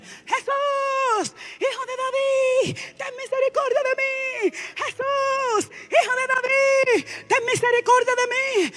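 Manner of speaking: desperate high pleading shout, close to tears; woman crying out. Acted. Canaanite woman cries out to Jesus, desperate high pleading shout. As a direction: cry out to Jesus as a desperate mother begging for mercy. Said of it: "la mujer venía gritando".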